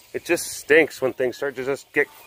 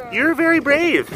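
high cooing praise; 11 semitones higher than usual. Real, not acted. Praising the cat.

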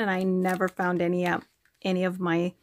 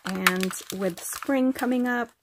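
normal talking voice

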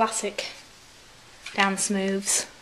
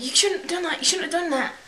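higher, louder panicky mock scolding; panicked scolding; 7 semitones higher, 4 dB louder than usual. Store guy scolds the girls over the alarm, panicked and higher. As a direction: play the guard freaking out at the girls. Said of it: "He's freaking out".

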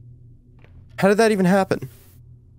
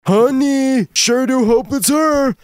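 higher, hopeful husband voice; husband character voice; 9 semitones higher, 3 dB louder, slower than usual. Acted. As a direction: call sweetly for the wife. Voices husband reading game lines.

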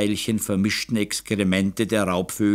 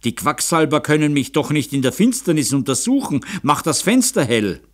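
raised, urgent impatient orders; irritated count demanding action at once; impatient command; 6 semitones higher, 3 dB louder than usual. A narrator's reading. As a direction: order them about, out of patience. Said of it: "Graf Ecke wurde ungeduldig".

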